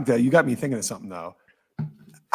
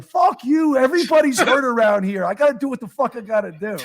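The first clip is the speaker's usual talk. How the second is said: high, loud mock yell at the umpire, laughing at the end; hammed-up defiant yell, much higher pitch; 9 semitones higher, 3 dB louder than usual. Manager yelling back at the umpire, a high hammed-up yell. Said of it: "He's like".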